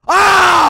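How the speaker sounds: high, loud scream; sudden gamer scream at a fail; sharp yell of alarm. Real, not acted. Screams at failed attempt.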